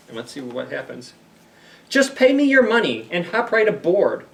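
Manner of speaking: lively picture-book narration; storybook reading. Acted. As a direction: play up the rhyme for the kids. Reads Dr. Seuss aloud to kids.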